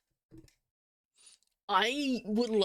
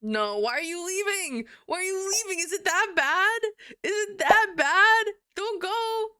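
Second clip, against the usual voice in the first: higher, whiny mock begging; mock pleading; 8 semitones higher than usual. Streamer begs a leaving viewer to stay, in mock distress. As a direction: plead like a clingy kid, hammed up.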